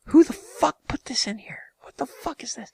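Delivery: hushed, stunned mock whisper; whispered disbelief. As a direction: whisper it like you can't believe it. Acted player baffled.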